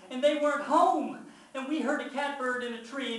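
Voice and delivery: loud, high, desperate outburst; yelled confession. A narrator's reading. Child confesses whole story to Mama, yelled outburst. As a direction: pour it all out at a yell. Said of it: "can't help it. I yell out".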